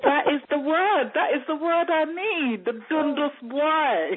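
thrilled discovery. Retold or imitated. Writer finding the word she needed, acted-out high excitement. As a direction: re-enact a eureka moment, stretching the last words.

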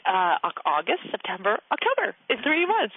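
normal talking voice

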